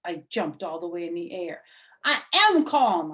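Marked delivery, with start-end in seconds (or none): 2.0-3.1 loud, high holler. Acted. Junie hollers at the teacher that she is calm. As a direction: shout it back defiantly. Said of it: "I hollered back".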